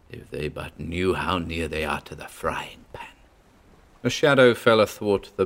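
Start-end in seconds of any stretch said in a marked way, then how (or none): 0.1-3.1 fierce whisper; hissed with contempt; whisper yelling. Acted. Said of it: "Pedro whispered fiercely".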